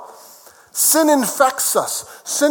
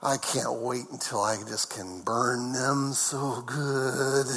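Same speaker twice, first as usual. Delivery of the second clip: low, hushed grumble, drawn out at the end; mock judging God; 10 semitones lower, 7 dB quieter, slower than usual. Preacher parodies a God eager to punish, low mock grumbling. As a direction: grumble it low and slow to yourself.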